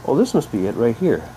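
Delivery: usual speaking voice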